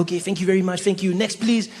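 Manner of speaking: put-on breezy French-accented clerk; cool French guy. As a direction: call the next customer with a French accent. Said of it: "very French, very French, cool guy".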